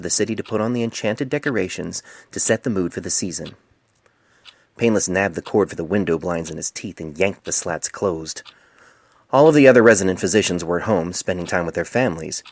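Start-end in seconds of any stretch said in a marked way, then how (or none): none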